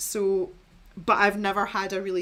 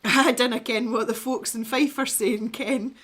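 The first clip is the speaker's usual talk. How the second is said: broad Fife Scots, higher and laughing; playful sing-song Fife accent; 4 semitones higher, 6 dB louder than usual. Shown as a demonstration. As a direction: put on a sing-songy East Coast Scottish accent. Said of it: "That's something like the, the East Coast".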